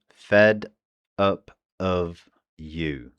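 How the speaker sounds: "fed up of you" slowed down to show each sound. Shown as a demonstration. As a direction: spell out "fed up of you" slowly, one sound at a time. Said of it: "this will become the I P A".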